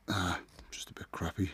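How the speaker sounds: breathy under-the-breath whisper; whispered aside. Real, not acted. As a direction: mutter it to yourself, barely voiced. Muttered aside while cleaning legs.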